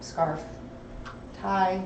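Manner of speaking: ordinary talk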